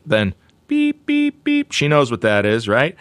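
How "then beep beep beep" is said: higher beep beep like an alarm; mock alarm beeps; smoke alarm beeping. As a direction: chirp like a smoke detector. Imitates smoke detector.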